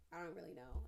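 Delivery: ordinary talk, nothing marked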